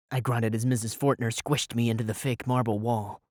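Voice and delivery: ordinary delivery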